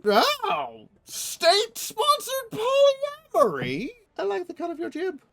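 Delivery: thrilled by a scandalous discovery; delighted shock. Real, not acted. Delighted at concubine tradition.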